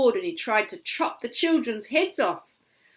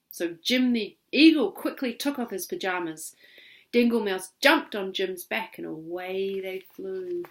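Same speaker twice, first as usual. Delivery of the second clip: ordinary delivery, nothing marked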